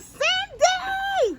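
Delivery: very high, loud holler; retold screaming. Friend outside yelling to wake her up, screaming. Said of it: "she out there screaming and yelling".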